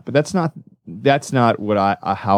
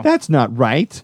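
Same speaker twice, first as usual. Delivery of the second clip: slow, higher-pitched mock protest; indignant objection; 4 semitones higher, slower than usual. Imagined viewer objecting to Spider-Man suddenly flying, indignant. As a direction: draw it out as a put-on complaint.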